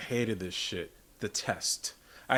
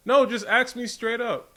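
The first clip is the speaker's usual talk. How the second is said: insistent; 10 semitones higher than usual. Real, not acted. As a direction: lay down the law, emphatic. Insists on directness, raised.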